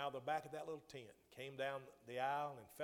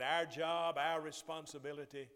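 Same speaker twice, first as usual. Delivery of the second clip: loud emphatic vow; 2 semitones higher, 10 dB louder than usual. Acted. Preacher voicing the disciples' vow.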